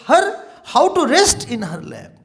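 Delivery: slow, rising devotional appeal; devotional preaching. Acted. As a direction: draw them towards the Goddess. Devotional sermon, slowed.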